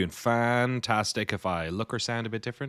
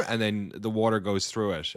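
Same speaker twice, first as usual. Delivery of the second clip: normal talking voice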